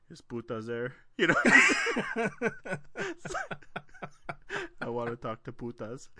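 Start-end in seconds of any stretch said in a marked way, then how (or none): 0.1-0.9 flat prank caller; same deadpan delivery; monotone voice. Retold or imitated. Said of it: "he would just say the same thing". 4.8-6.1 flat prank caller; same deadpan delivery; monotone voice. Retold or imitated. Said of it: "he would just say the same thing".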